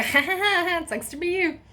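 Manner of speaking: high, loud taunting ha ha ha; mocking fake laughter. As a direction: laugh at her like teasing friends. Friends mocking her fear.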